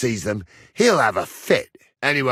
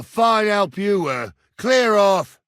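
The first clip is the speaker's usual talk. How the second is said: high, raised cross shout; angry dismissal; 7 semitones higher, 4 dB louder, slower than usual. Acted. Man dismissing a useless helper, shouting crossly. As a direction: send him off in a temper.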